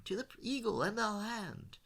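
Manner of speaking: high-pitched crowd-style cheer; shouted toast. A narrator's reading. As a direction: join in a rousing patriotic toast. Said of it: "shout along with him".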